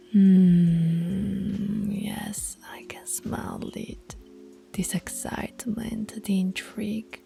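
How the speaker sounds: hushed, drawn-in savoring of a scent; savoring murmur. Acted. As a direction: drop soft, as if breathing in the smell. Alpha sniffs out pup's excitement.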